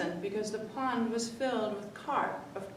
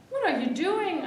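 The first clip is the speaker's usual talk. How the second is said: put-on child shouting from the window in alarm; shouted; 9 semitones higher, 8 dB louder than usual. A narrator's reading. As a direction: shout it high and loud out the window. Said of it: "I shouted".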